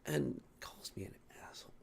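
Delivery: hissed under the breath; fuming whisper. Real, not acted. Vlogger muttering about the customer, angry whisper. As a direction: whisper the grievance through irritation. Fuming aside while venting.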